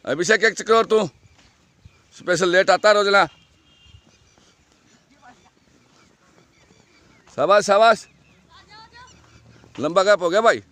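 shouted coaching. Real, not acted. Coach urging runners across track.

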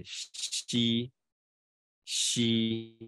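吸 held long with an airy palatal x; drawn-out, clearly articulated 吸; tongue-surface x. Shown as a demonstration. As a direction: model 吸 slowly and evenly. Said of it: "然后第三个是吸".

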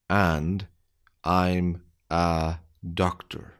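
slow, deliberate, word by word; careful slowed-down reading; slow dictation. Shown as a demonstration. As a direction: spell it out slowly for beginners. Slow repeat for learners.

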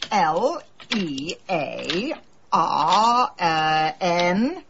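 slow letter-by-letter spelling, L E A R N E R. Shown as a demonstration. Spelling her Scrabble word out letter by letter, slow and spaced. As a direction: spell each letter slowly.

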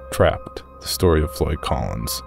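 ordinary delivery

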